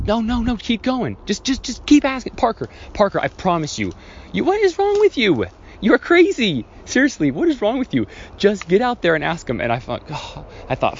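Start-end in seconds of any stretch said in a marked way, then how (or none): 0.0-3.9 high-pitched pleading mom voice; insistent mother, pitched way up; imitated urging mom. Imitating mom urging crying son on. 4.3-9.6 very high, exasperated mother voice; mock-exasperated mom, pitched way up; imitated exasperated mom. Imitating mom losing patience with son.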